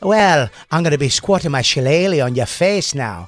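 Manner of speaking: hammed-up Irish tough guy; Irish vigilante threatening a squatter; Irish accent. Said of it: "I do it in an Irish accent".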